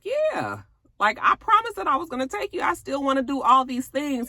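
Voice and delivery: high, quick eager promise; eager reassurance. Retold or imitated. Husband promising his wife the trip, high and eager. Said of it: "he was just like".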